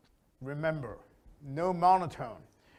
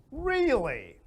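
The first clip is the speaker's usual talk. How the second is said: "Really" much higher and louder, then falling; 9 semitones higher, 4 dB louder than usual. Shown as a demonstration. Teacher showing the strong reply, up and high. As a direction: pitch "Really" high with surprise. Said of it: "See, up and high".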